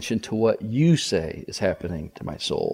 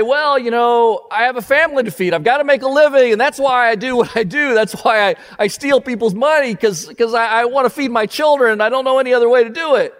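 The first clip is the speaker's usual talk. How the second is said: excuse making; 10 semitones higher, 5 dB louder than usual. Acted. Imagined excuse of tax collector.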